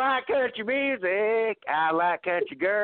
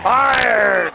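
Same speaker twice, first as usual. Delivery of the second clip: shout; 3 semitones higher, 10 dB louder than usual. Acted. Acted shouting in a bit.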